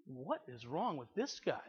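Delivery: 压低声音的内心嘀咕; 低沉轻声自问; inner thought. Retold or imitated. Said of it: "they're thinking".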